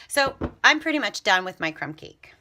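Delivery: usual speaking voice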